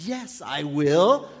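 slow, loud, proclaimed yes; slow, loud divine promise; booming God. Acted. God answering Pharaoh.

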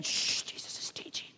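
hushed usher whisper. Retold or imitated. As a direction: shush in a whisper. Ushers hushing again.

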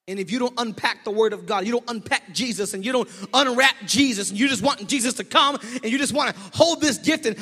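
loud, high, rising preacher's call; building sermon. Acted. Pastor pressing the congregation about unused gifts, fervent building preaching. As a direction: drive it home, building.

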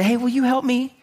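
a hint of a grieving widow asking for help; pleading widow. Widow who never asked Jesus for help, lightly acted plea. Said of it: "She didn't say".

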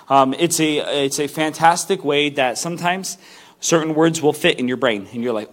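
ordinary delivery

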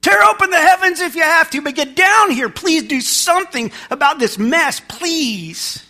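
high, loud, desperate plea to heaven; screaming prayer. Acted. Worn-down believer begging God to come down, screaming. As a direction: cry out at full force, then plead. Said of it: "something in you is screaming".

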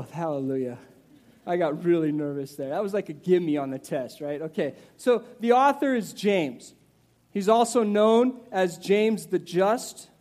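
ordinary talk, nothing marked

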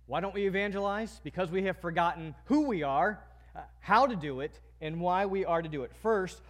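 usual speaking voice

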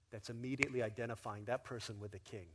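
ordinary delivery

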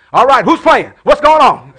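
loud, high mock-booming question; jokey loud entrance. Dad stepping into noisy house, calling out loudly. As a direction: barge in, calling out. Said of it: "I step in the house".

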